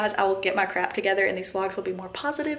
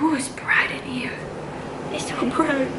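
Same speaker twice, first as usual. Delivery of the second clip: put-on small, higher, half-whispered toddler voice; voicing sleepy toddler; 4 semitones higher than usual. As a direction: speak for a child squinting at the light. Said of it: "He's like".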